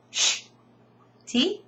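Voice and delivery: usual speaking voice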